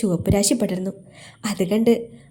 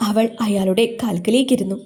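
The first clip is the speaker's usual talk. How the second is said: usual speaking voice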